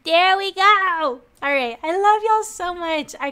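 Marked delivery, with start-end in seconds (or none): 0.0-1.2 high, loud, stretched cheer; triumphant. Real, not acted. Streamer gets the raid ready, triumphantly. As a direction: sing out the success.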